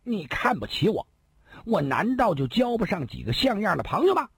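high indignant shout. A narrator's reading. Second brother yells back at the insulting elder brother, high indignant shout. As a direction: yell it, stung and offended. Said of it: "大叫地说道".

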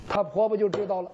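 usual speaking voice

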